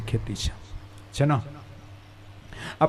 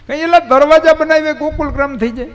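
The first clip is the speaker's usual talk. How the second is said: scoffing challenge; 9 semitones higher, 6 dB louder than usual. Acted. Mocking the gate-building idea.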